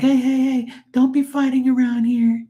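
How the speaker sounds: high-pitched mock woman breaking up a fight; imitating a woman. Lady at the cable office stopping the fight, in a high mock voice. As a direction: squeak it out like the scolding lady. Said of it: "a woman was like".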